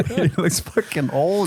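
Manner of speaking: laughing in disbelief. Real, not acted. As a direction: laugh through the words. Laughing at photo.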